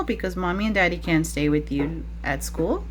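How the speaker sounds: ordinary talk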